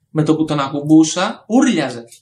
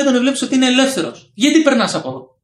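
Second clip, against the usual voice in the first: put-on raised high, indignant old woman's comeback; mock neighbour retort; 7 semitones higher, 3 dB louder than usual. Old neighbour snapping back at him, mock indignant. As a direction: throw the blame back at him, pitched up.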